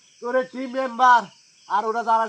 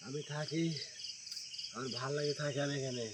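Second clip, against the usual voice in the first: quiet menacing spirit voice; low and sullen, like a jinn; 10 semitones lower, 20 dB quieter than usual. Acted.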